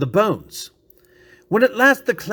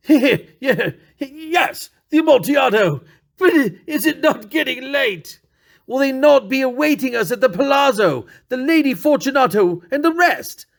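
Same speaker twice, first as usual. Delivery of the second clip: nervous laughing plea; 8 semitones higher than usual. Acted. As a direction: laugh it off but beg to leave.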